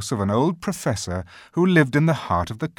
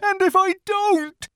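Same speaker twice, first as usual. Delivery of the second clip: very high, loud tearful faun voice; a faun breaking into tears; faun beginning to cry; 8 dB louder than usual. A narrator's reading. Faun answering Lucy while starting to cry. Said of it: "said he, beginning to cry again".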